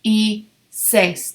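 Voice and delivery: slow, separated Diez y seis; spaced teacher model of Diez y seis. Shown as a demonstration. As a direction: say Diez y seis word by word, slowly. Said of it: "we're going to go the easy route".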